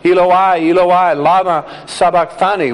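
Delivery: measured loud cry in a Bible reading. A narrator's reading. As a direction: lift the voice as if calling out, without screaming. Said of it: "cried out in a loud voice".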